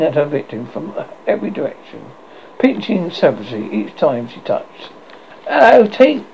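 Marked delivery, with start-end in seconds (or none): 5.5-6.2 high, louder hail; calling out. A narrator's reading. The wondering boys greet Tinkerbell, calling out. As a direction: hail someone with a rising call. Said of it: "cried the wonderful".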